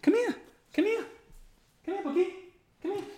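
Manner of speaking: very high, sing-song dog call. Real, not acted. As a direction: coax the dog in a high sweet voice. Calling the dog over.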